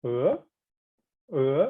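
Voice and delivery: "e" gliding up like a question; rising tone. Shown as a demonstration. Teacher leading practice of the second tone, rising tone. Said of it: "Second one".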